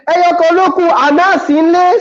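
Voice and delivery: high put-on teasing voice; high teasing buyer; mocking buyer. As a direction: tease the seller in a high put-on voice. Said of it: "I said".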